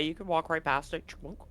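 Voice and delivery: normal talking voice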